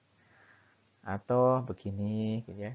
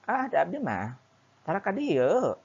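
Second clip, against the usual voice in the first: higher, louder Sundanese lilt with long curving tune; long drawn Sundanese dialect; 7 semitones higher, 6 dB louder than usual. Shown as a demonstration. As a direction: stretch each phrase in a sing-song Sundanese dialect. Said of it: "dialek yang ngomongnya itu agak panjang". (logat Sunda yang panjang dan berlekuk, lebih tinggi dan keras)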